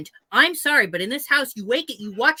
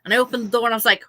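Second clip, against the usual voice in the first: ordinary delivery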